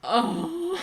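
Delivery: high, choked sob of a word; teary oh. Real, not acted. Reader choking up, high teary oh. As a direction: let it crack with tears. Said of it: "I'm not okay".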